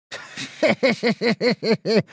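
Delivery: high, smug laugh; gloating chuckle. Acted. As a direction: laugh at the spoiled girl. Mradish gloats.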